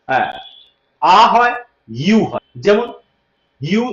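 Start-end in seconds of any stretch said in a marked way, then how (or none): none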